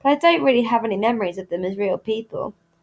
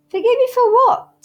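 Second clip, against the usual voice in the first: high, loud, baffled question; flummoxed; 6 dB louder than usual. A narrator's reading. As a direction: caught off guard and confused. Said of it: "thoroughly flummoxed".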